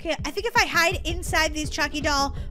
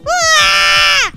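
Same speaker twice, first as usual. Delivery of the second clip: long, high, loud wail; drawn-out shriek; wailing scream; 7 semitones higher, 10 dB louder than usual. Acted. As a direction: scream it out as you die. Wailing after being killed.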